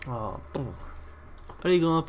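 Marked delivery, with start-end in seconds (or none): 1.6-2.1 urging. Real, not acted. Urging character to climb.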